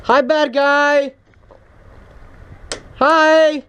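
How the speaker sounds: slow, high taunting greeting; mock the enemy sweetly, drawn out; taunting sing-song.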